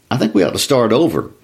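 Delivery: plain reading voice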